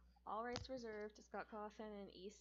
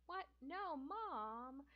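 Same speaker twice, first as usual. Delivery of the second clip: drawn-out, higher whiny protest; wailing; 5 semitones higher, much slower than usual. A narrator's reading. Kid objecting to Mom about his brother, wailing. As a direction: whine it in mock dismay, swooping up on the words. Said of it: "you wail".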